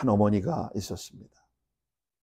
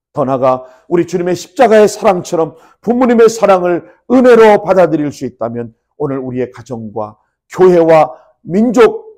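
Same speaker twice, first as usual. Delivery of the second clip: loud, raised sermon climax; forceful, high pulpit exhortation; impassioned preaching; 5 semitones higher, 12 dB louder than usual. Real, not acted. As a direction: proclaim it with rising fervour.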